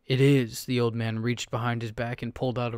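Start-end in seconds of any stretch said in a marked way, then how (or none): 0.1-0.7 loud flat insistence; insist, final and hard; cold insistence. Acted. Old man insists angrily.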